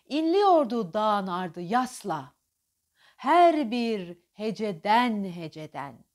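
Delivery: slow, stressed recital; poetry recitation. Shown as a demonstration. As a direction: weigh every word slowly. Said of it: "Şiir bol vurgu demek". (vurgulu şiir okuma)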